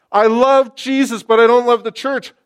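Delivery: preacher repeating the line in outrage; shouted repeat. Acted. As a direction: fling the quote back at them. Repeats quote at full force.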